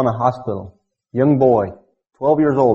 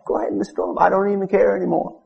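mock fed-up prayer; 6 semitones higher than usual. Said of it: "And you said".

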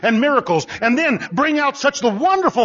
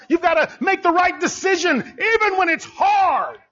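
preaching at full force; 7 semitones higher than usual. Acted. Preacher urging the church to say no, climbing to a shout. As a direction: climb to a shout on "even when it's hard".

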